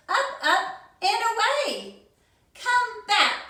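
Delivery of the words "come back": shouted. Acted. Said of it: "shouted Cyril".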